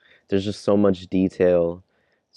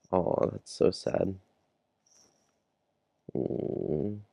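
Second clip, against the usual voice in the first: softly saddened; 10 dB quieter, much slower than usual. Real, not acted. Moved by a character's death.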